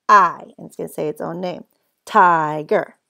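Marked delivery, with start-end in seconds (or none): 0.1-0.5 "I" said loudly as the letter's own name; long I sound. Shown as a demonstration. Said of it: "this I needs the long sound". 2.0-3.0 "Tiger" with a loud, stretched TI; long I sound. Shown as a demonstration. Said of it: "this I needs the long sound".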